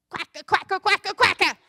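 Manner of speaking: loud, high mock duck quacks; loud duck quacking. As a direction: lean back and quack proudly like a duck. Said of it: "leaned back and said".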